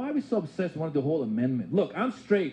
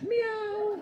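cat meow. Retold or imitated.

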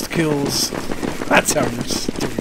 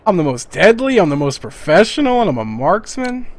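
boastful; 3 semitones higher, 4 dB louder than usual. Real, not acted. Boasting to teammates.

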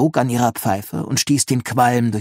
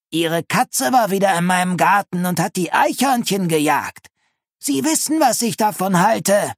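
high-pitched, scolding neighbour voice; nagging old neighbour; 9 semitones higher, 3 dB louder than usual. Acted. Neighbour complaining to Linus about his cat, nagging. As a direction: complain in a high, reproachful character voice.

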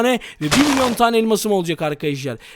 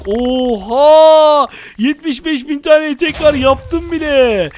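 thrilled; 5 semitones higher, 5 dB louder, much slower than usual. Real, not acted. Thrilled by huge TNT haul.

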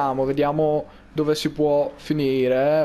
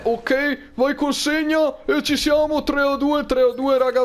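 excited; 8 semitones higher than usual. Real, not acted. Team scoring in game.

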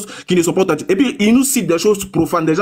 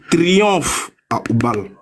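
slow solemn quote; much slower than usual. Acted. Reads quoted cry slowly.

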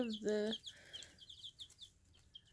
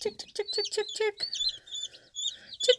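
calling chickens; 10 semitones higher than usual. Real, not acted. Calling chickens with tick sounds.

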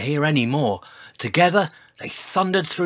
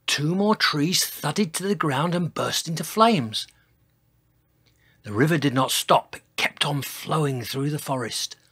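ordinary delivery

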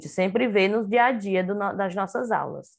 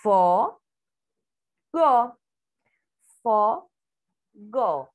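FO, pause, GO, each on its own; teacher dictating fo, go one syllable at a time; separated syllables; 3 dB louder, much slower than usual. Shown as a demonstration. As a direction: isolate Fo and go with long gaps. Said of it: "As sílabas".

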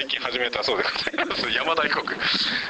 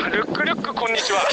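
usual speaking voice

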